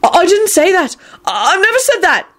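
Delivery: very high panicked denial; desperate yell. A narrator's reading. Neville denying everything, desperate. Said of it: "Neville yelled out desperately".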